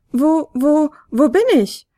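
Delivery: leicht gespielte schwache Fionella-Stimme; zaghaft, eine Spur höher, noch halb im Schlaf. A narrator's reading. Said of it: "die schwache Stimme seiner Fionella". (schwach und verschlafen fragend)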